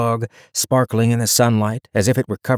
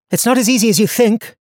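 lightly voiced young heroine, higher; young woman voice; 12 semitones higher, 4 dB louder, faster than usual. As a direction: speak her lines higher and brighter. Donia's dialogue voice.

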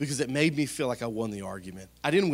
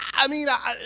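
caught-out stammer; 12 semitones higher, 7 dB louder than usual. Retold or imitated. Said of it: "caught out stammering".

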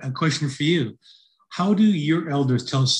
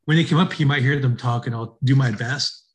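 ordinary talk, nothing marked

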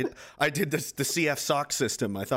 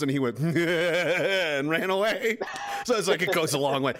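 ordinary talk

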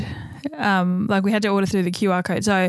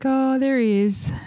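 delighted slow; 4 semitones higher, slower than usual. Retold or imitated. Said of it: "I'm like".

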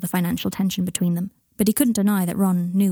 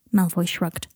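plain reading voice

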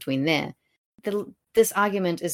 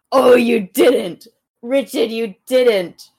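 loud, higher, drawn-out disbelief; mock scandalized; 5 semitones higher, 9 dB louder, slower than usual. Reader reacting to Richard's brazen bedchamber line, mock scandalized. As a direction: cry out in playful outrage.

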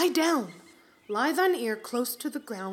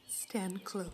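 unvoiced whisper, hushed urgency; 4 semitones lower, 10 dB quieter than usual. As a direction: whisper so he won't hear. Hiding from Falstaff.

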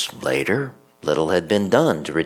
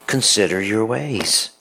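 raised, emphatic preacher voice; preaching; 3 semitones higher than usual. Acted. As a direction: open the sermon with force. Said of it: "preacher opens homily forcefully".

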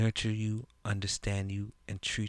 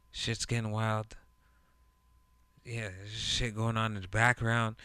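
ordinary talk, nothing marked

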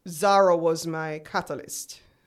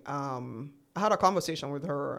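ordinary talk, nothing marked

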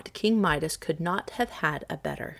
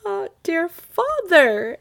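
put-on high, slow, loud childish wail; tearful child; 11 semitones higher, 7 dB louder, much slower than usual. A narrator's reading. Little daughter answers her father through sobs, tearful wail. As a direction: draw it out like an upset little girl. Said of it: "as well as her sobs would let her".

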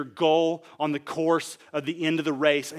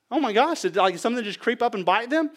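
higher-pitched startled questioning; mock alarm; 7 semitones higher, 4 dB louder than usual. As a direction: react in comic shock at their disappearance. Acts alarm at a vanished runner.

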